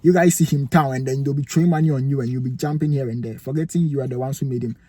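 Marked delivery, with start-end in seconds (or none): none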